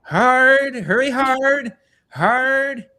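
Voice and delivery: high, loud bellow of a curling skip urging the sweepers; curling coach yell. Retold or imitated. Asked for the coach bit, yelling at imaginary sweepers. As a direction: holler at the sweepers to brush harder. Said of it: "can you do the coach thing?".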